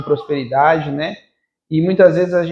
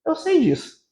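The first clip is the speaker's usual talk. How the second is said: small, high, soft voice; little inner voice; 9 semitones higher, 5 dB quieter than usual. Retold or imitated. Speaker voicing the little voice that answered in prayer, high and soft. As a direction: imitate a little voice, high and hushed. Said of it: "a vozinha veio".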